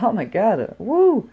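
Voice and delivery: reeling at the insult; shocked. Retold or imitated. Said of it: "And I'm like".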